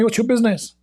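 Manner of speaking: high, indignant mock snap; mock angry retort. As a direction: imitate the annoyed man's retort. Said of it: "He got angry".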